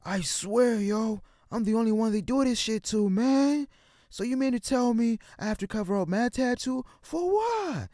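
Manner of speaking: put-on high-pitched drunk and stoned rant. Narrator voicing drunk, high J R complaining about fines, high-pitched. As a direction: whine it way up high, stoned and fed up. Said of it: "I gotta use my J R high voice".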